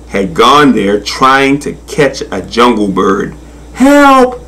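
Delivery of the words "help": high, drawn-out storybook cry; cry for help. A narrator's reading. Trapped crocodile to anyone nearby, high cry for help. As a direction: call out for help, pitched way up. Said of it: "she cried".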